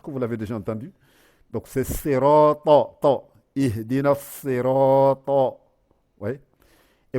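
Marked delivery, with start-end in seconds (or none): 1.5-5.6 sirata with a full, swollen emphatic ta, slow and loud; heavy emphatic ta. Shown as a demonstration. Teacher showing students the heavy ta, slow and emphatic. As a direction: puff the ta in sirata out big. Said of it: "moi le lire comme le ta".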